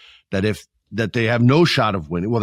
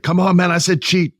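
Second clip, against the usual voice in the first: high, louder mock pushy manager; Don Callis insisting; 9 semitones higher, 4 dB louder than usual. Manager insisting his wrestler cheat, pushy and high. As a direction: press him hard to cheat, pitched up. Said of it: "And he's like".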